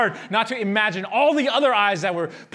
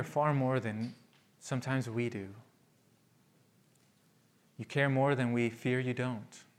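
quiet prayer; 7 semitones lower, 9 dB quieter than usual. Acted. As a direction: pray gently for the congregation. Closing prayer.

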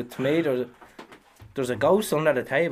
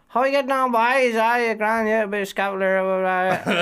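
much higher, louder yapping country voice; culchie coworker impression; 10 semitones higher, 5 dB louder than usual. To his mate, imitating the culchie chippy, much higher and louder. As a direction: ham up the chatty country lad. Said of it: "he says".

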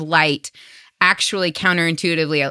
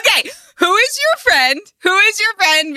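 excited delight; 13 semitones higher than usual. Real, not acted. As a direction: ask it thrilled and delighted. Excited reaction to scream idea.

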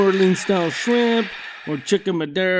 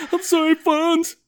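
much higher, frantic pleading voice; scared sidekick; 11 semitones higher, 4 dB louder than usual. Retold or imitated. Fonzie's friends apologizing to him in terror, high frantic pleading. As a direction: grovel fast and scared. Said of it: "people were scared".

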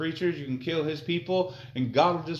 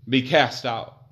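loud, slow proclamation; declaiming scripture; 8 dB louder, slower than usual. Acted. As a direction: announce the judgment.

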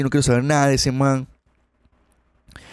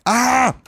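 sudden high cry of dismay; a loud startled "ah"; shocked cry; 13 semitones higher, 4 dB louder than usual. Retold or imitated. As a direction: yelp as if struck by the insult. Reacting to being insulted.

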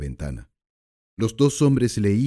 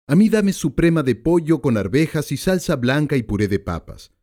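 lightly voiced tough guy, a bit higher; a hint of a surly hoodlum; gangster ordering; 6 semitones higher than usual. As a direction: demand the meal curtly. Narrator voices the gangster again.

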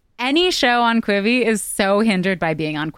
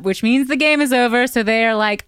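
ordinary talk, nothing marked

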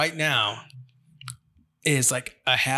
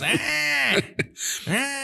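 put-on toddler yell; 13 semitones higher than usual. His youngest pointing at the fridge, high toddler yell. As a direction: yell like a demanding little kid. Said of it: "He goes".

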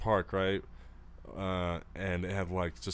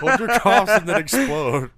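much louder and higher, slow and drawn out; 12 semitones higher, 13 dB louder, slower than usual. Pretend. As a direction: boom it out like a mock command. Joking command, building loud.

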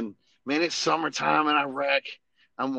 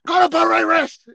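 much higher, loud barked command; new sergeant ordering his buddy to parade rest; 14 semitones higher, 12 dB louder than usual. Retold or imitated. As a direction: bark it like a drill sergeant. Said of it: "he goes".

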